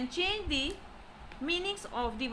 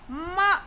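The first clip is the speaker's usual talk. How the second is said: "ma" higher and stressed; falling tone; 4 semitones higher, 4 dB louder than usual. Shown as a demonstration. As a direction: punch "ma" like a command. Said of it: "It is said with the falling tone".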